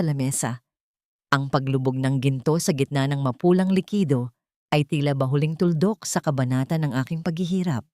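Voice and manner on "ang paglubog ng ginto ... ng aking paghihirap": ordinary delivery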